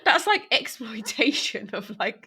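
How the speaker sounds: amused, laughter breaking into the words; laughing. Real, not acted. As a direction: say it through real laughter. Teasing friend's deal, laughing.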